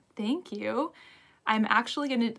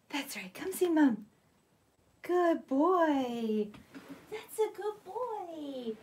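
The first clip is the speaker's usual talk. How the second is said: praising the dog; 7 semitones higher, much slower than usual. Real, not acted.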